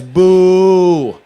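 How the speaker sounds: loud, high mock boo; crowd boo. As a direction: boo like the whole room. Crowd booing.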